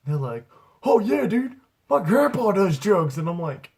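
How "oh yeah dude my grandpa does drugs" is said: put-on high, loud eager kid voice. Young neighbor kid bragging about grandpa, high eager voice. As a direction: blurt it like a naive teen.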